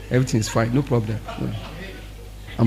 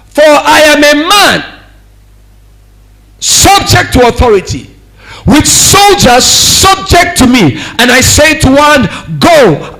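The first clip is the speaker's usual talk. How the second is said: forceful scripture reading; 5 semitones higher, 7 dB louder than usual. Acted. Preacher reads scripture with force.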